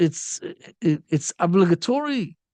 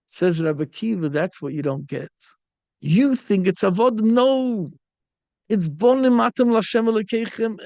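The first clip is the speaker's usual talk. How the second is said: usual speaking voice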